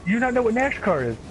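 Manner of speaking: high laughing disbelief; mock incredulous friend. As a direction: imitate the friend's shocked voice.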